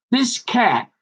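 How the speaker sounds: usual speaking voice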